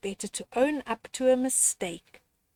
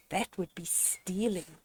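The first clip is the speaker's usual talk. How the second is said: hushed disapproval; 4 semitones lower, 7 dB quieter than usual. Acted. Disapproving after gasp.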